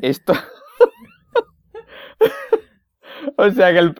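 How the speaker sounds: giggling through the words; cracking up while talking; laughing. Real, not acted. Laughing over the prank caller.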